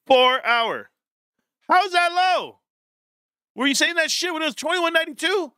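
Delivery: high-pitched incredulous protest; exaggerated high-voiced disbelief; mock indignation. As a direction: argue it way up high, mock outraged.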